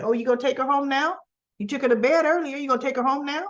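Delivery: incredulous rapid-fire questioning; mock indignation. Recapper scolding Dex for taking her home now, indignant.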